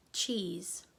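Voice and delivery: usual speaking voice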